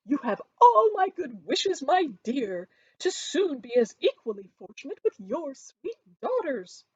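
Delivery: high, loud, syrupy well-wishing matron; gushing Mrs Bennet. A narrator's reading. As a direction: pat her friend's arm and gush. Mrs Bennet voiced in story.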